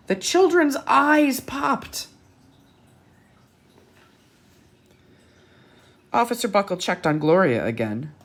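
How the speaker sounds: put-on lively read-aloud to children; bright picture-book narration; storybook reading. A narrator's reading.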